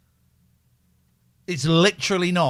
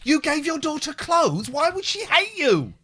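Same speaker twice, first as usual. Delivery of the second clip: mock outrage; 12 semitones higher than usual. Mocking the mother with a hammed-up yell. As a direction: bellow the fake indignation.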